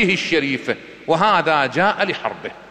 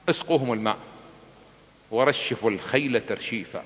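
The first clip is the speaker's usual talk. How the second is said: gentle command; 6 semitones lower, 6 dB quieter than usual. Acted. Husayn orders watering enemy army.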